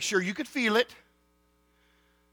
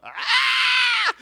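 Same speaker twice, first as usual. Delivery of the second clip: high, loud pained yell; retold yelp of pain; 9 dB louder than usual. Boy yelping as dad's belt connects, loud high cry. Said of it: "I remember I went".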